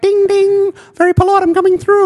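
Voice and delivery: high, prim polite voice; sweet mock-polite cyclist; silly clown.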